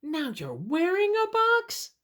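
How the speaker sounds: very high, squeaky disbelief; amazed, rising storybook question; incredulous question. Acted. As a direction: ask it high and astonished. Repeats the question to ask bunny.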